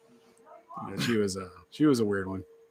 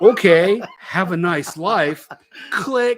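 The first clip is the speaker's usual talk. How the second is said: mock dismissive; 8 semitones higher, 8 dB louder, much slower than usual. Hanging up on wife.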